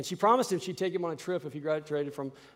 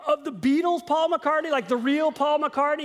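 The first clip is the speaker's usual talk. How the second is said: much higher, louder incredulous questions; stunned disbelief; 9 semitones higher, 5 dB louder than usual. Retold or imitated. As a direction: voice flying up in amazement. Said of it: "And I'm like".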